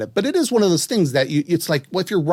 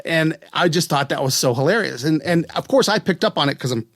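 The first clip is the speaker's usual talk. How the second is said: ordinary talk, nothing marked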